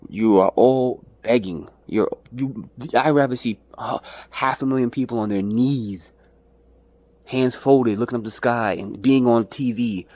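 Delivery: normal talking voice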